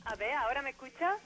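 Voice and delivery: caller barely audible down the line; distant quiet phone voice; faint phone line. Real, not acted. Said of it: "Esto se escucha muy flojito".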